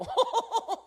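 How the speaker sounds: high, sharp burst of laughter; a scornful laugh as the temper snaps; mocking laugh. [name]'s temper flares. (높고 날카로운 비웃음)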